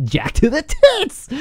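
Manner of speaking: high, slow, drawn out with glee; too excited. Real, not acted. Trader carried away by a bullish candle, slow giddy glee. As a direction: relish every word, carried away. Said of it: "I'm getting too excited".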